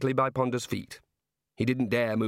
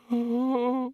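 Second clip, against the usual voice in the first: high nervous squeak; tiny startled squeak; 12 semitones higher than usual. A narrator's reading. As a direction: let out a frightened little squeak. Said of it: "squeaked the senior wrangler".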